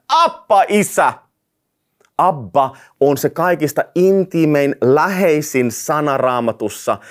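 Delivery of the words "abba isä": joyful shout. Shown as a demonstration. Pastor showing the congregation a shout of praise to God. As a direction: cry out "Abba" like a child calling its father. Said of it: "ja sanomme".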